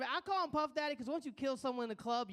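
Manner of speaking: usual speaking voice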